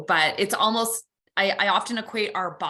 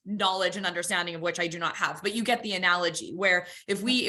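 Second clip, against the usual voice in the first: normal talking voice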